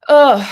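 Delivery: sudden loud high ugh; loud groan. Real, not acted. Psychic groaning at the caller's grim future. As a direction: groan it out in dismay.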